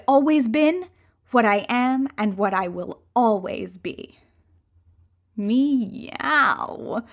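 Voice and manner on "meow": cat meow. Acted. Becoming a cat.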